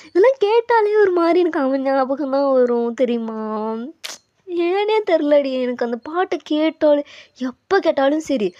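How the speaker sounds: put-on loud, excited girl confiding in her friend; carried away, emphatic and loud; lovesick girl, gushing. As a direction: gush about the beloved song. Lovesick girl gushes about the song.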